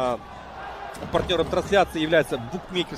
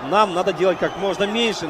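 usual speaking voice

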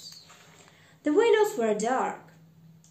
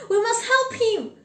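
high, loud urgent plea; crying out; 11 semitones higher, 8 dB louder than usual. A narrator's reading. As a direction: cry it out urgently to the ghost. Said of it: "cried Scrooge".

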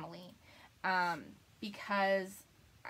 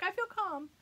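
mock calm; 10 semitones higher than usual. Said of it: "She's like".

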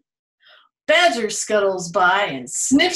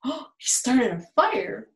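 ordinary delivery, nothing marked

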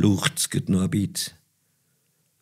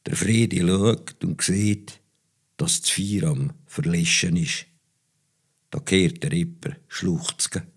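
plain reading voice

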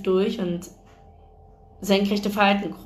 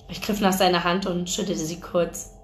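usual speaking voice

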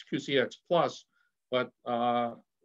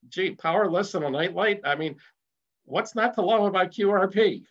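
higher, excited enthusiasm; carried away with enthusiasm, voice climbing and louder; getting excited; 5 semitones higher, 3 dB louder than usual. Real, not acted. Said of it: "Sorry, I'm getting excited here".

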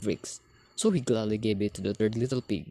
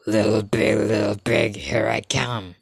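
big bad wolf; 3 semitones lower, 5 dB louder than usual. A narrator's reading. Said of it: "said the wolf".

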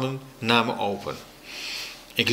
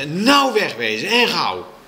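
higher, angry order; schreeuwende moeder; 6 semitones higher than usual. A narrator's reading. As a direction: send them off sternly. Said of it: "schreeuwde mijn moeder tegen de meester". (boos bevelend)

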